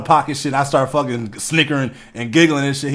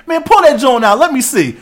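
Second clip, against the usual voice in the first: high and loud, greedy to see; eager shout; 12 semitones higher, 7 dB louder than usual. Retold or imitated. Coworker begging to see the phone, high excited shout. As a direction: bark it out, can't wait to look. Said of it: "he was like".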